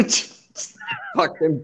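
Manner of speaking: normal talking voice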